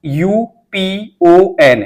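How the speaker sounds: very slow, each letter spelled out separately; slow classroom dictation of single letters; spelling letter by letter. Shown as a demonstration. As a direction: dictate each letter slowly with a pause after it. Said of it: "ऐसे पढ़ना है दोस्तों".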